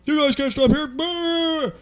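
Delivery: high, loud mock firemen yelling; scolding firemen. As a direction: holler a telling-off in a silly high voice. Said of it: "firemen are gonna come out and go".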